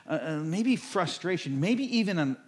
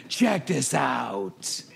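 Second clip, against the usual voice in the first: slow proud reveal; 3 semitones lower, 3 dB louder, much slower than usual. Acted. God presenting his creation.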